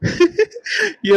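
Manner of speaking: amused laugh. Acted. Ragu laughs at his friend's teasing, amused laugh.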